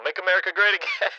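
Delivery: through a megaphone effect; tinny megaphone PA voice. Shown as a demonstration. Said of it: "it sounds like I'm talking through a megaphone".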